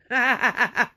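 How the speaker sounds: high, pained burst of laughter; bitter laugh. Real, not acted. Caller laughing at being hung up on, bitter. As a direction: laugh bitterly through the hurt.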